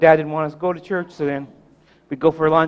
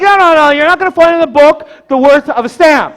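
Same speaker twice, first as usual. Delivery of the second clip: loud, high grandfatherly protest, acted out; emphatic objection; 10 semitones higher, 9 dB louder than usual. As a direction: cut the kid off insistently. Grandpa cuts off the boy.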